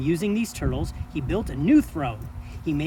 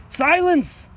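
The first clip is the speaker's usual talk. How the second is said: sharp, high, loud bark of a king; one furious royal bark for silence; barked command; 11 semitones higher, 4 dB louder than usual. A narrator's reading. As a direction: bark it down at him like a tyrant. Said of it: "the king of the turtles barked back".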